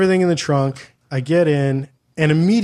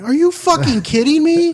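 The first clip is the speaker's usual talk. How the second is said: much higher, louder incredulous yell; shrieking disbelief; 6 dB louder than usual. Retold or imitated. Reacting to the nervous driver, high incredulous yell. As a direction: explode in disbelief, voice cracking high. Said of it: "And I'm like".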